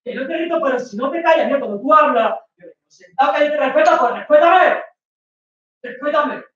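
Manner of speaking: high furious shout, dragged out; angry shouting. Acted. Boyfriend yelling at girlfriend in front of friends, angry shout. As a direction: yell it to shut her up.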